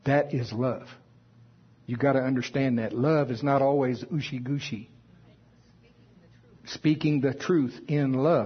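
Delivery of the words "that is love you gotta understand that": ordinary delivery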